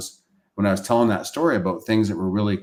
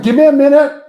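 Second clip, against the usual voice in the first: overwhelmed plea; 13 dB louder than usual. Retold or imitated. Overwhelmed by the news.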